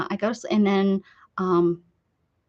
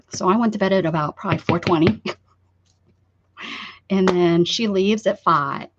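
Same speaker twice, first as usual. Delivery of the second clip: ordinary talk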